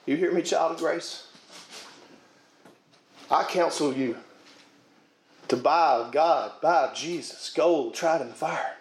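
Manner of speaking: full preacher's cadence, rising on key words; preaching. Acted. Pastor urging his flock to give something up, preaching. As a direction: preach it up to the back pew.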